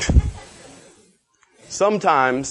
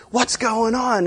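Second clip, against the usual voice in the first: high-pitched baffled question; mock bewilderment; 9 semitones higher, slower than usual. Disciples wonder at the endless food, mock bewilderment.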